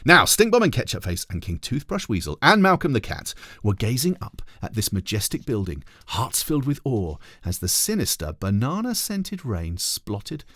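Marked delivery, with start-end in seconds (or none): none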